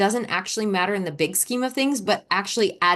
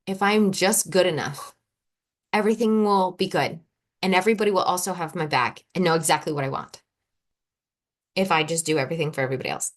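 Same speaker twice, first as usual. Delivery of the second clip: ordinary talk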